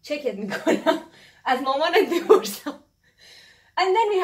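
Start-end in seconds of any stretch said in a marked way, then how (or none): none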